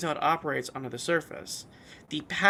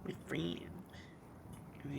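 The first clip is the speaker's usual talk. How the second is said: whisper; 17 dB quieter than usual. Real, not acted. Echoing quietly.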